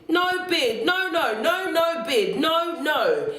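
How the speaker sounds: high-pitched bedtime protest; childish refusal. A narrator's reading. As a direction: chant the refusals like a stubborn small child.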